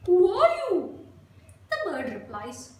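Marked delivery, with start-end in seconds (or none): 0.1-1.1 loud, high monkey question. A narrator's reading. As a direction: call it out as a nosy monkey. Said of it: "The monkey asks the bird".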